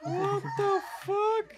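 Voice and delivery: high, slow, stunned cry; shocked. Real, not acted. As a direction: gasp it out in disbelief. Reacting to video, shocked.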